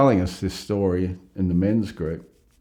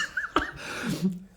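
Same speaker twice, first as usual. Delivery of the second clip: high breathy laugh; 12 semitones higher, 6 dB quieter than usual. Real, not acted. Teller cracks up again.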